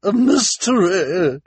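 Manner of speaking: high, loud, drawn-out thrill; gasping relish at juicy news; excited. A narrator's reading. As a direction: squeal it slowly with delight. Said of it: "Missus Morrow said excitedly".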